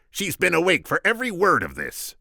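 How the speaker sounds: high, loud Vizzini voice; squeaky scheming Sicilian. Acted. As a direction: pitch him up, sharp and cocky.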